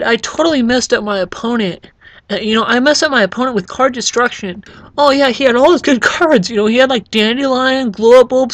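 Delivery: high, sing-song mock bragging; mocking smug player. Gamer mocks players bragging about card destruction, high and smug. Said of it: "when people are like".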